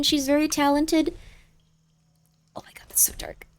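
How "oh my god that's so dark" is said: hushed scared whisper. Real, not acted. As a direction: breathe it out in fear. Scared in dark game area.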